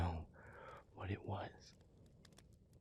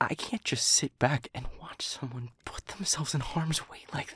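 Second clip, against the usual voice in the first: pitched up and urgent, pleading against it; distressed protest; 5 semitones higher than usual. Acted. Speaker refuses to watch partner walk into harm, distressed protest. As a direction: argue desperately, voice climbing high.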